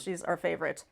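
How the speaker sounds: ordinary talk, nothing marked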